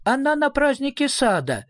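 put-on high, mournful child's voice; small girl's plaintive answer; sad little voice. A narrator's reading. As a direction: say it sadly in a tiny high voice. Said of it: "печально произнёс голосок".

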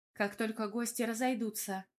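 ordinary delivery, nothing marked

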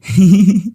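high, loud cackling laugh. Acted. Ghost boy laughs at the mother. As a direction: burst out laughing from the tree. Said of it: "mà cười lên ha hả".

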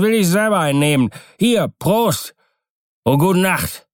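high, swooping tipsy sailor voice; drunken boatswain, triumphant. A narrator's reading. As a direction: play a cocky drunk having the last word. Drunken boatswain dismisses Keawe.

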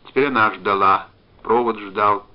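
ordinary delivery, nothing marked

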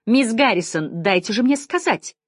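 trying to outshout. A narrator's reading. Decker tries to cut through Janine's yelling, outshouting her. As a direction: push over her to get a word in. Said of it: "попытался перекричать её Деккер".

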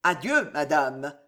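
low, deliberate goodbye; slow farewell. A narrator's reading. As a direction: take your time, pitch it low. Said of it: "dit lentement Montmorency".

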